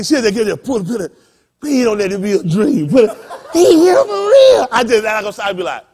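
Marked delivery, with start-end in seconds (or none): 1.6-4.7 loud, rising to a high squeal; beg, then burst out in mock disbelief; excited girl. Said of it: "That girl so excited".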